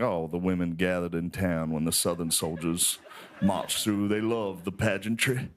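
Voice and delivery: low, lovestruck Southern historian; Shelby Foote. Acted. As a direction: savor it in a Southern accent, slowing at the end. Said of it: "sexual how much he loves the South".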